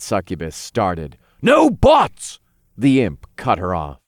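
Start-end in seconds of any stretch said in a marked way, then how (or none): 1.4-2.4 high, loud cut-off; firm shout. A narrator's reading. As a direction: cut her off sharply. Imp cuts her off.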